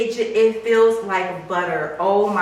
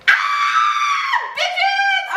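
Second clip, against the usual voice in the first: very high, loud laughing scream; ecstatic scream; 3 dB louder than usual. Real, not acted. Buyer celebrating the bag with viewers, screaming. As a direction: shriek it out in sheer joy.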